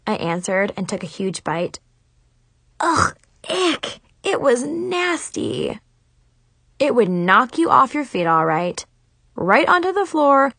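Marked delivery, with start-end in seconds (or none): 2.8-5.8 high, slow grossed-out groan; disgusted. A narrator's reading. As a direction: recoil from a nasty taste. Disgusted after the bite.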